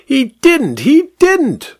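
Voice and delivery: high shriek of protest. A narrator's reading. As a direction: shriek the denial. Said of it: "shrieked the vicar".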